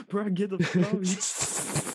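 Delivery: real high laughter through the words; laughing. Real, not acted. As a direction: crack up while saying it. Laughing at the prank.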